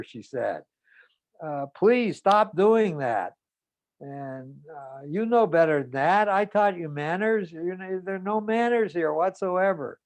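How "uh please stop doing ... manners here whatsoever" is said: mother scolding. Retold or imitated.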